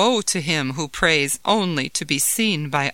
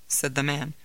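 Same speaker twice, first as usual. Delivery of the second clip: ordinary delivery, nothing marked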